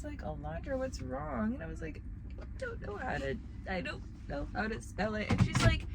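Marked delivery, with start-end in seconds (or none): 0.2-1.5 gentle teacher. Retold or imitated. Teacher asking kindly. 2.4-5.3 put-on teary little kid. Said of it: "I start crying".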